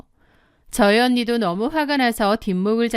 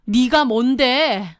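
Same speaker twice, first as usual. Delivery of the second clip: gasp, then slow indignant challenge; outraged disbelief; 3 semitones higher, much slower than usual. Acted. Wife challenging the mistress's right to the house, outraged. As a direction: draw it out in affronted fury.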